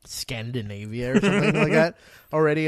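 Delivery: ordinary delivery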